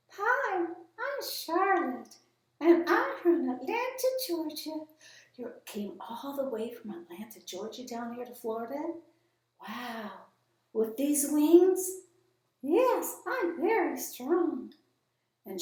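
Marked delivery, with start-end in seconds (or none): 0.1-4.9 high, slow, sweet bird puppet introducing herself; a proud little dodo saying hello; Charlotte puppet voice. Acted. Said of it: "introduce you to Charlotte". 12.6-14.7 high, slow, sweet bird puppet introducing herself; a proud little dodo saying hello; Charlotte puppet voice. Acted. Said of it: "introduce you to Charlotte".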